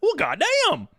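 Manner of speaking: high-pitched comic exasperation; mock astonishment. Ribbing the co-host about hating Goodfellas, mock astonishment. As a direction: exclaim it in playful shock. Mocking her wish for movie's end.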